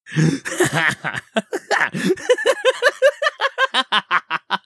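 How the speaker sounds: high shrieking hag laugh; witch cackle. Acted.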